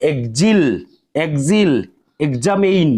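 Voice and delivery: এক্সিল and এক্সামিন with egz; emphatic modelled gz words; teacher demo. Shown as a demonstration. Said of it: "ইউই জ উচ্চারণ করতে হবে".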